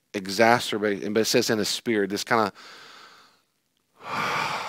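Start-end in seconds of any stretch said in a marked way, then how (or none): 4.0-4.6 a long, breathy, unvoiced sigh; deep sigh. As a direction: sigh as if worn out by them. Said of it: "he sighed deeply".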